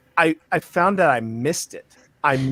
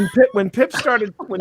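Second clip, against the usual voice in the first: ordinary delivery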